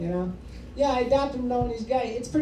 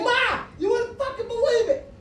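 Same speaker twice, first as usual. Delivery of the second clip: much higher, bursting with glee; excited news; 13 semitones higher than usual. Retold or imitated. Son bursting to tell his mom, giddy high voice. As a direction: tell Ma like it's the best day ever. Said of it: "I'm like".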